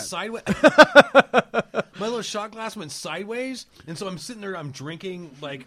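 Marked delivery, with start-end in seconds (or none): 0.5-1.9 high, loud laughing outburst; loud burst of laughter. Real, not acted. Drink spilled, loud laugh.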